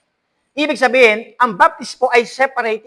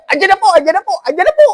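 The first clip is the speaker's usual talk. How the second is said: a much higher, louder sing-song Andyan na po with rising ends; mock boastful call; 11 semitones higher, 8 dB louder, faster than usual. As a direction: crow it to the guys across the room. Acts out proud sing-song call.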